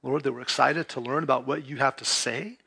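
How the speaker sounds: earnest soft-spoken prayer; pastoral prayer. Real, not acted. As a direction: pray over the congregation, hushed and low. Pastor leads congregation in prayer.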